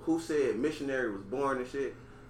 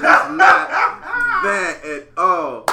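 laughing; 11 semitones higher, 13 dB louder, much slower than usual. Real, not acted. Laughing protest.

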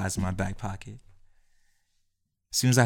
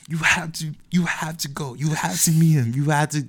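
a higher, pushy dad voice, lightly put on; mock insistent dad; 6 semitones higher, 3 dB louder than usual. Dad pressing his son to meet the recruiter, higher insistent voice. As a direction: urge him like an eager father, pitched up.

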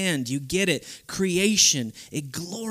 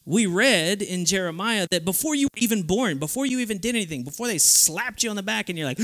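normal talking voice

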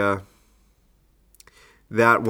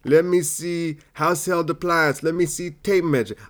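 Steve Harvey; 6 semitones higher than usual. Shown as a demonstration. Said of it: "I was gonna do a Family Feud thing".

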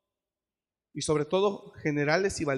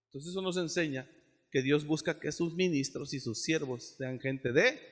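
ordinary talk, nothing marked

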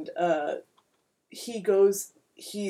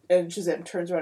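ordinary delivery

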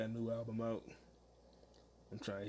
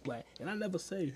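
ordinary delivery

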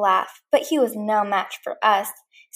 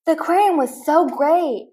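a bit higher, brighter boast; excited shout; 5 semitones higher, 3 dB louder than usual. Acted. Andy bursting into his room, excited shout. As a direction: burst in sharing the news. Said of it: "Andy shouted".

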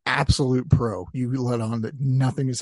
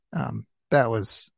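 ordinary talk

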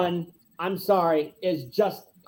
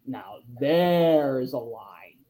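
slow, hushed admiration; slow, awed; 4 semitones lower, much slower than usual. Retold or imitated. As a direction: lower and very slow, savouring it. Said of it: "And I just like".

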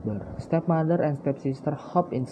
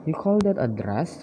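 ordinary delivery

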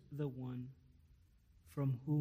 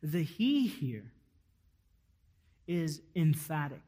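ordinary delivery

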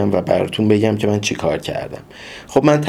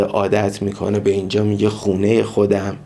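ordinary delivery

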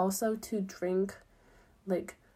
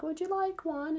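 cheery offer; 9 semitones higher than usual. Retold or imitated. Voicing the tote bag handers.

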